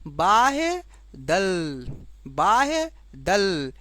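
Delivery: बाह्य दल stretched out very slowly; very slow teacher's dictation of बाह्य दल. Shown as a demonstration. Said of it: "इसे हम लोग बोलते हैं".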